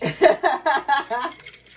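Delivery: high loud burst of laughter. Real, not acted. Laughing at the little bitch, loud high cackle.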